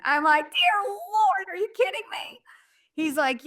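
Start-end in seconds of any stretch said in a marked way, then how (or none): 0.5-2.4 high, incredulous cry; shocked disbelief. Retold or imitated. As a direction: squeak out disbelief at the cost. Shocked at the price.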